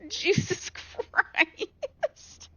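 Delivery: high, breathless laugh through swearing; shaken laughing curse. Real, not acted. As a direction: laugh off the fright. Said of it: "you just gave me a goddamn heart attack".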